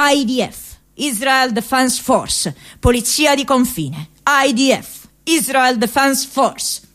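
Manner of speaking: a hint of a slow army announcement over a loudspeaker; megaphone shouting. Retelling the army megaphone in the street, mild mock call. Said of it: "una voce attraverso il megafono che gridava".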